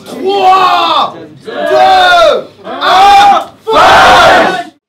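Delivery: shouted countdown. Real, not acted. Host counts down the battle start.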